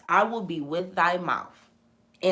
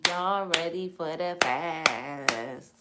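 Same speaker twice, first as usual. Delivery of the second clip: slow drawn-out call; much slower than usual. Real, not acted. Host teasing viewers with a slow stretched-out call. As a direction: stretch every word out very slowly. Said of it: "host repeats call to viewers".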